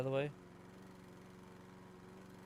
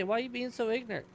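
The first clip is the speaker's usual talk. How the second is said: quick, high mock of the people who say it; high, fast imitation of a know-it-all; mocking quote; 12 semitones higher, 3 dB louder, much faster than usual. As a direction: rattle it off like someone who mispronounces. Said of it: "that's how people say".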